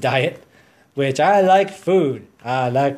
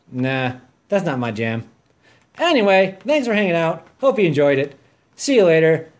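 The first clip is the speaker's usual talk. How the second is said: ordinary talk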